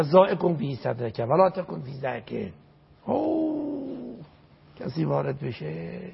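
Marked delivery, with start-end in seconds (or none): 3.0-4.2 much higher, very slow drawn-out oh; stretched, high gasp of awe; long awed oh. Acted. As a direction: let the wonder stretch out high. Marvelling at the delight of paradise.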